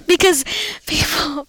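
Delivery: ordinary talk, nothing marked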